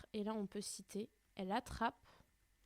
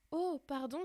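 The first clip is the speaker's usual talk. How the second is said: higher, louder sudden apology; exclaiming; 5 semitones higher, 5 dB louder than usual. Acted. Alice apologising to the frightened mouse, higher. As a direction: blurt it out, startled. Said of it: "s'écrie Alice".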